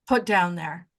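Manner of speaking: usual speaking voice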